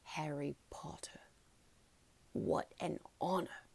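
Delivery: awed whisper of the famous name. A narrator's reading. Bartender whispers Harry's name in awe, barely voiced. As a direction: hush it like a secret treasure. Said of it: "whispered the old bartender".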